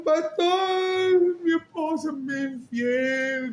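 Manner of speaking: high, wailing sung-out plea; mock the weeping husband; hammed-up tearful complaint; imitating a crying man. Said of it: "me decía".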